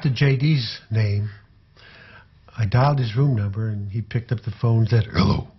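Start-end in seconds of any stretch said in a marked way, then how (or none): 5.1-5.5 growled hello in a very low bass voice. Retold or imitated. J D picking up the phone the following morning, very low bass voice. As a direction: drop right down low to answer the phone. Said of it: "get down real low and talk a little bit like J".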